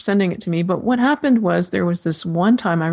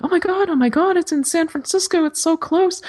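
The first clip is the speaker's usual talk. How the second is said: much higher, breathless excitement; thrilled gushing; 11 semitones higher than usual. Retold or imitated. Retelling how her heart cheered, in giddy high excitement. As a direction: squeal it like you can't believe your luck.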